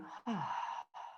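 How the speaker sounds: soft, falling sigh of release; demonstrated sigh. Shown as a demonstration. Teacher showing listeners how to let go, soft sigh. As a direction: let the breath go in a quiet sigh. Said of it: "do a few sighs like that".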